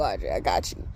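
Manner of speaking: dropped low, quietly reassuring; lightly voiced God speaking personally. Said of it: "I just keep hearing God say".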